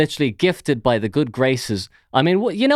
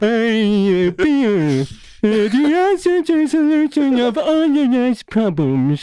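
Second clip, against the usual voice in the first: mock Homer Simpson voice; 10 semitones higher, slower than usual. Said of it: "Homer with Down syndrome".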